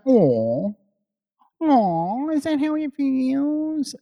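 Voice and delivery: high, slow mocking baby talk. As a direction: coo it like talking to a baby. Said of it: "you know the baby voice he does".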